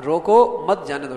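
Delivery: loud روکو, then مت جانے دو as one group; forceful روکو, break before مت; pause after روکو. Shown as a demonstration. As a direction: hit روکو hard as a stop order, then مت جانے دو. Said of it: "میں نے کہا".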